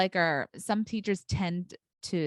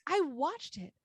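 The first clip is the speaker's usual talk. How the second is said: exasperated teacher; 9 semitones higher than usual. Retold or imitated. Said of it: "I'm like".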